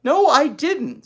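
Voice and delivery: high, loud, slow protest; older sister denial. Acted. Older sister protesting to her little sister. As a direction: deny it firmly like a big sister. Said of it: "said Beezus".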